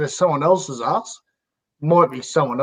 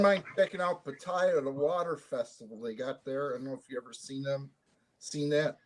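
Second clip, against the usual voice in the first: distant, muffled, far from the mic; sounding like from the bottom of a well; 4 semitones lower, 13 dB quieter than usual. Real, not acted. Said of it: "You sound like you're falling down a well".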